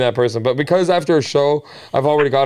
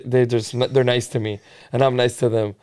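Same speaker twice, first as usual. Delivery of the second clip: ordinary talk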